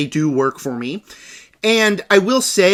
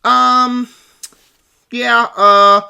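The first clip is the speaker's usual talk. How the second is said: raised, loud mock hesitation; dithering movie character; 5 semitones higher, 6 dB louder, much slower than usual. Reviewer imitating the Bride unable to decide, loud dithering. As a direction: hold the filler words as a mock ditherer. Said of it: "her character, the bride, constantly in this movie is like".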